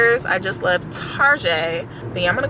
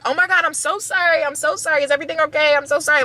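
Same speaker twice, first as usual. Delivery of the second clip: high, gushing apology, imitating herself; hammed-up flustered sorry; overly apologetic; 7 semitones higher, faster than usual. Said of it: "so apologetic".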